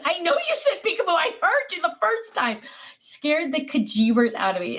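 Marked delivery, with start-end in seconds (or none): 0.0-2.6 flustered reply. Retold or imitated. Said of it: "And I said".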